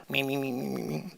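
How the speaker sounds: tiny, soft squeaky gibberish; low-volume mock babble; squeaky background chatter. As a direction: mimic someone jabbering faintly in the background. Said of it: "I can hear Armando in back".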